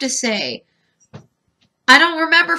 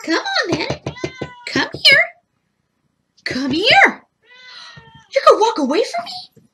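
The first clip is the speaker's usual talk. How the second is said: high pet-talk coaxing; coaxing the cat; 11 semitones higher than usual. Real, not acted. Calling the cat up to the camera in high pet talk. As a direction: coo to the cat.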